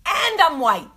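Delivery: high, loud burst of mock dismay; exaggerated, loud protest; comic exclamation. Exclaims a comic self-doubt.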